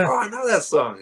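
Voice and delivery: mild excitement. Retold or imitated. Shop guy recognises the song.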